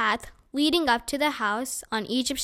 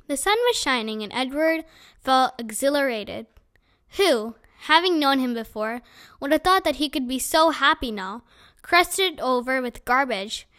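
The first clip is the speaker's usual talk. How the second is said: ordinary delivery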